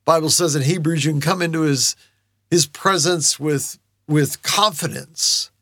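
ordinary talk

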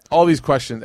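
ordinary talk

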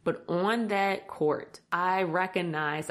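normal talking voice

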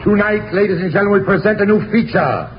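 ordinary delivery

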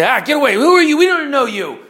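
put-on young sailors waving off Elijah's warning with a loud brush-off; dismissive shout. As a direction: shoo the old man away.

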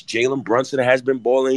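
ordinary talk, nothing marked

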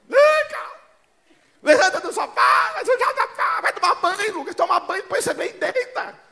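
grito agudo de mãe brava; mãe gritando. Retold or imitated. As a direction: gritar alto e agudo como mãe irritada. Mãe gritando com o filho. (mãe gritando com o filho pra sair do sofá)

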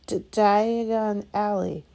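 "Diagon Alley" choked out slow and loud through a cough. A narrator's reading. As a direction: sputter "D-, Diagon Alley" around a mouthful of ash. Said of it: "he coughed".

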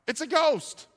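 high-pitched cry of alarm; frightened cry. A narrator's reading. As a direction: cry out as terrified disciples, read aloud. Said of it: "they cried out".

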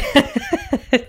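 a bright high burst of laughter. Real, not acted. Telling the funny scene, a high laugh. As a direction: laugh freely, it cracked you up. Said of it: "just cracked me up".

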